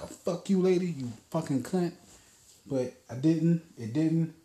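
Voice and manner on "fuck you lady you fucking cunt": imagined yell. Pretend. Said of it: "a, like".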